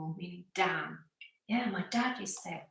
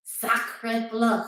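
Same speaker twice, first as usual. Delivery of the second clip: loud, over-the-top French attempt; Frenchest French accent; 3 semitones higher, 8 dB louder than usual. Shown as a demonstration. Told to say it the Frenchest way, exaggerated French accent. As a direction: push the French accent as far as it goes. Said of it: "say it in the Frenchest way that you can".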